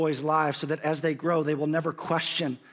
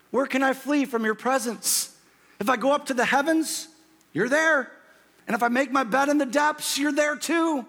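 rising preaching; 9 semitones higher than usual. Acted. Preacher quoting Psalm 139.

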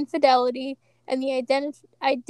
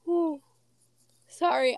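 sighing through a yawn; sleepy yawn mid-word; 3 semitones higher than usual. Real, not acted. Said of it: "I'm yawning".

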